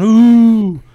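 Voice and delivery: high, drawn-out mock ghost "ooh"; ghostly wail. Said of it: "a sheet in the middle of the night going".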